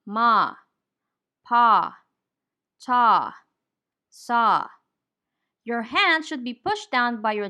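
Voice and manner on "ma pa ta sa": "ma", "pa", "ta", "sa" with the jaw dropped wide and a louder, open ah; open ah syllables. Shown as a demonstration. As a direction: drop the jaw on each: "ma", "pa", "ta", "sa". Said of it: "put your hand under your chin and say".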